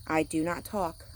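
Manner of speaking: plain reading voice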